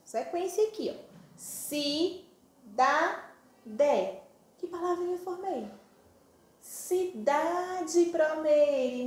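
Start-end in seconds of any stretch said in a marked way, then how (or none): 1.4-4.2 si, da, de as three separate beats; syllables in sequence. Shown as a demonstration. Teacher sounding out syllables for children, each one separate. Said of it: "eu vou falar primeiro, sequência aqui". 6.6-9.0 syllables joined. Shown as a demonstration. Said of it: "falar rapidinho pra ver que palavrinha".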